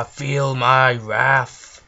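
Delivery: slow, booming villain threat; enraged boss roar. Pretend. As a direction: loom over them, drawing each word out. Villain casting spell.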